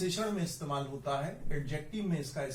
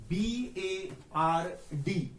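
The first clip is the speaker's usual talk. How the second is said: B A R D spelled very slowly; slow spelling; 2 semitones higher, much slower than usual. Shown as a demonstration. Teacher spelling B A R D slowly for students. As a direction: draw out each letter. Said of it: "वो कौन सा word है?".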